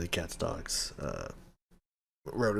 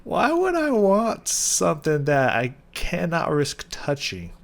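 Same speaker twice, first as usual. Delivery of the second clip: higher, slow disbelieving voice; mock incredulous self, higher and slower; incredulous quote; 7 semitones higher, 4 dB louder, slower than usual. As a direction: act baffled at the idea, drawn out. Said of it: "I was like".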